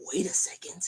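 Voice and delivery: hushed disbelieving whisper; stunned whisper. Retold or imitated. Fan hearing the band at the pump, whispered disbelief. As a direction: breathe it in disbelief.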